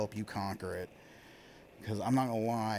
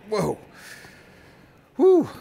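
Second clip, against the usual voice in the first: relieved whew; 11 semitones higher, 15 dB louder than usual. Real, not acted. Coaster drop relief.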